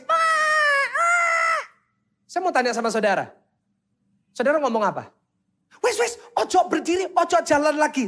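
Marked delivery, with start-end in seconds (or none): 0.1-1.6 long, very high bawling cry; imitated child's wail. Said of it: "Terus dia nangis". 5.8-8.1 high-pitched, exaggerated fussing; mock coddling parent. Mock parent soothing fallen child.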